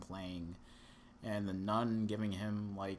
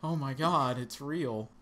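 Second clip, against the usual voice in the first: loud, higher mock alarm; mock shock; 5 semitones higher, 8 dB louder, slower than usual. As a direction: act startled by your own joke. Mock shock at own realization.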